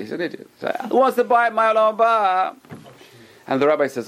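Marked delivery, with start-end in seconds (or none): 0.9-2.6 imitated tipsy merchant's announcement; announcing an offer. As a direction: call it out to the whole room. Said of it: "He starts announcing".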